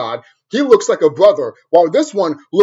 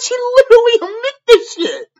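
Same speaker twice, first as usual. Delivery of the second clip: squeaky, much higher and louder disbelief; stunned disbelief; 6 dB louder than usual. Retold or imitated. Telling a friend what the teacher admitted, squeaky disbelief. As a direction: squeal it like you can't believe it. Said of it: "I'm over here like".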